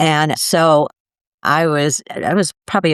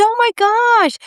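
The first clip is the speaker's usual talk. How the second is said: overwhelmed gasp; slower than usual. Retold or imitated. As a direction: burst out as it hits you. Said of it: "I said".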